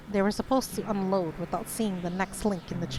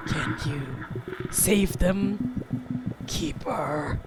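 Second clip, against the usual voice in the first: creepy voice. Shown as a demonstration. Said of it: "read a creepy's voice".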